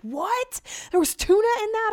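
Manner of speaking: high-pitched mock outrage; hammed-up horrified disbelief; freaking out. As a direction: imitate someone shocked, voice leaping way up. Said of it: "he immediately starts freaking out".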